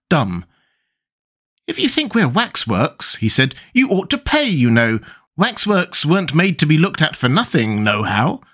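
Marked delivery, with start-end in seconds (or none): 1.7-3.2 put-on high, childish fat boy voice; a boyish Tweedledum, piping and smug; Tweedledum's voice. 3.7-8.4 put-on high, childish fat boy voice; a boyish Tweedledum, piping and smug; Tweedledum's voice. Tweedledum continues.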